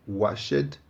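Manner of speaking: washed as two syllables, wash-ed; wrong washed. Shown as a demonstration. Teacher showing learners the wrong form, two-syllable washed. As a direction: say washed with a spoken e. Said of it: "Não é washed".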